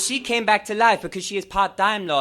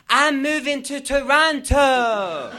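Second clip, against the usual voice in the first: higher, louder, slow anguished cry; dramatic wail; 6 semitones higher, 5 dB louder, slower than usual. Acted. Fan announcing her move, mock despair. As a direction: wail it like a tragedy.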